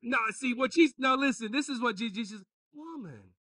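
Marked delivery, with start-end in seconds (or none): 2.7-3.3 soft, falling Woman; quietly soothing, no challenge; gentle, hushed address. Acted. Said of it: "that's how he said it".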